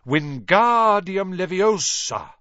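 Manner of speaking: Leviosa with a long drawn ga, slowly corrected; correcting pronunciation. A narrator's reading. Hermione correcting Ron, drawing out Leviosa slowly. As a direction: stretch the ga in Leviosa, prim and slow.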